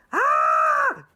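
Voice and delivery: loud, high-pitched comic scream; scientists' scream. Acted. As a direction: scream like a roomful of panicked scientists. Scientists scream at coming asteroids.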